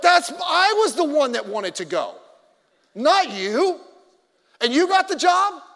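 retelling his jealous complaint to the friend who got the job; jealous outburst. Retold or imitated. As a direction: build from complaint to outraged disbelief.

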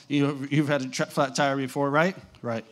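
normal talking voice

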